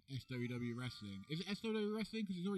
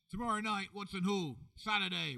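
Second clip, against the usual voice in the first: loud, higher promo call; announcer hype; 5 semitones higher, 5 dB louder than usual. Pretend. Two hosts hyping the wrestling show to camera, booming promo call.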